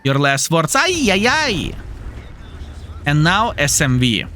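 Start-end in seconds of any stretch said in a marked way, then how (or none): 0.7-1.7 high excited ay ay ay; excited cry. Real, not acted. Streamer cheering a shot, high excited cry. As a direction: cry out in delight.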